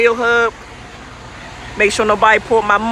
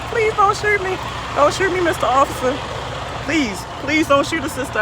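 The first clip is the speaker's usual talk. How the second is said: hammed-up high plea for mercy, then softer; mock pleading; 5 semitones higher, 4 dB quieter than usual. Driver begs the officer not to shoot her, mock terror. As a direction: beg the officer not to shoot, half joking.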